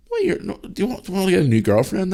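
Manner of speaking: high cheeky kid voice, imitating his son; imitating a son teasing his dad about a girlfriend; son's cheeky question. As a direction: mimic a cheeky young boy teasing. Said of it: "He goes".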